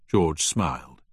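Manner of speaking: ordinary delivery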